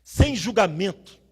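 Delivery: loud, rising sermon over music, words stretched; preaching at full force. Acted. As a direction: drive the message home with full pulpit force. Pregação com música, em crescendo. (pregação inflamada, em voz alta e com ênfase)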